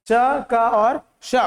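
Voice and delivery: च, क and श each said slowly and apart; teacher spelling out sounds slowly; slow sound demo. Shown as a demonstration. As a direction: lay out the three sounds one by one. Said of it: "तीन उच्चारण बताए हैं".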